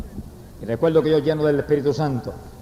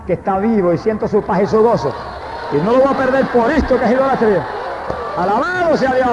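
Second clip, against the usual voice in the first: rising fervent preaching; 6 semitones higher than usual. Real, not acted. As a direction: build higher and firmer into a praise cry. Testimony rises into preaching. (prédica fervorosa que sube hasta la alabanza)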